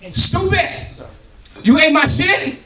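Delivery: very high indignant yell; outraged outburst. Acted. Waking to find the food eaten, acted outraged yell. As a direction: shriek the accusation in mock outrage.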